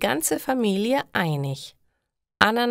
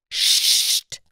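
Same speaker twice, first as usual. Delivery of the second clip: sharp shh for silence; shushing hiss; 5 dB louder than usual. A narrator's reading. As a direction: hush the newcomer. Shushing in meditation room.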